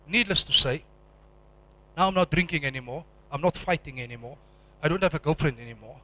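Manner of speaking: ordinary talk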